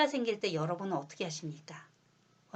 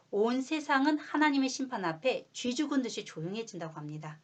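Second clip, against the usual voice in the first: ordinary talk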